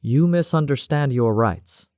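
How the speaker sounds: slow, icy correction; slow and cold. Acted. Cavendish corrects the defiant doctor, slow and cold. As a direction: freeze her out word by word. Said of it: "her voice slow and cold".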